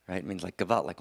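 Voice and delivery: usual speaking voice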